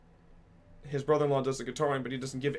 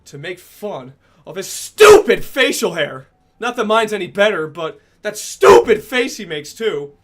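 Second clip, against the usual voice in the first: louder, higher mocking rant; excited rant; 5 semitones higher, 13 dB louder, slower than usual. Real, not acted. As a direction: rant with rising scorn. Ranting about someone's face.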